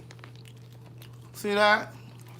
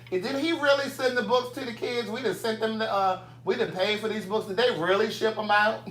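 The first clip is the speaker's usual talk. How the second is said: higher-pitched doubting voice; put-on skeptical questioning, raised pitch; mocking skeptics; 5 semitones higher than usual. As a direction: imitate suspicious doubters, pitched up. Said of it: "some skeptics in here like".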